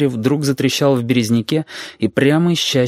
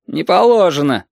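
higher-pitched official refusal; barracks refusal; 6 semitones higher, 3 dB louder than usual. A narrator's reading. Sentry bars the road to the highway, barracks tone. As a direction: refuse like a sentry quoting rules. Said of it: "казарменный тон".

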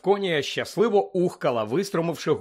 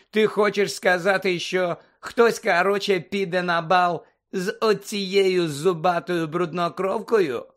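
higher-pitched taunting drawn at her; mild jeering disbelief; 4 semitones higher, 4 dB louder, slower than usual. A narrator's reading. Malfoy mocking whoever would take Hermione, jeering. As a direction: taunt with raised, scornful incredulity.

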